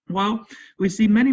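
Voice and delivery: ordinary delivery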